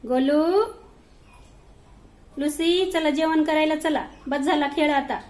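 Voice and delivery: high, loud calls, then coaxing; calling the cats. Real, not acted. Woman calls her playing cats to dinner, high and loud. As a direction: call them in from play.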